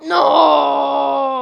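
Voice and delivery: loud despairing cry of no; anguished shout. Real, not acted. Streamer watching his tower fall, anguished shout.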